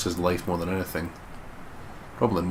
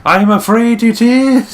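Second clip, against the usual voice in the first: much higher, louder, slow theatrical reveal; hammed-up dramatic; 11 dB louder, slower than usual. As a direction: deliver it like a melodrama villain.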